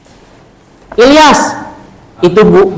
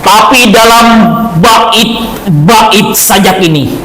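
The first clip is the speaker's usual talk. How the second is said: poetry declamation. Shown as a demonstration. Teacher opening the chain poem recital in class. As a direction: declaim it with intonation and expression. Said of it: "Bagaimana cara membaca puisi dengan intonasi".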